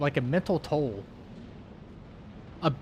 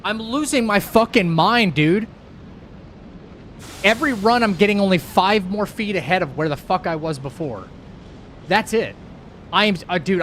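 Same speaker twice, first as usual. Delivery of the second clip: loud, raised ranting; angry outburst; 3 semitones higher, 4 dB louder than usual. Real, not acted. Streamer losing it over tiny progress, loud angry rant. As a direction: let the rage boil over. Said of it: "apologies for my outburst".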